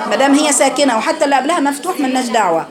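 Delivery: usual speaking voice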